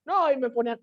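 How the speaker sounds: normal talking voice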